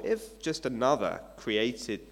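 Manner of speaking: usual speaking voice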